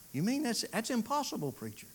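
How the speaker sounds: high-pitched, incredulous objection; doubting listener. Acted. Listener challenges the preacher on his claim, high-pitched disbelief. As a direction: pitch it way up as a sceptic interrupting.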